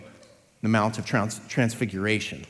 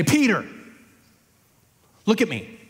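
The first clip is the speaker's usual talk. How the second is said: high, insistent call to a friend; urgent appeal; 6 semitones higher than usual. Acted. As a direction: get his attention, pleading firmly. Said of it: "put your hand on his shoulders".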